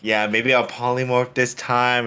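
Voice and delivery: ordinary talk, nothing marked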